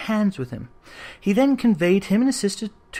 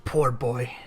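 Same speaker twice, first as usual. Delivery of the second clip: pity; 5 semitones lower than usual. A narrator's reading. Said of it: "in pity for his former self".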